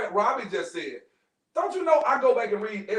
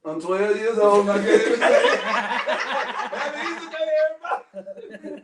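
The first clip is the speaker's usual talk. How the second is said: put-on kid's Easter speech; 3 dB louder than usual. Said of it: "Easter speech getting ready to come".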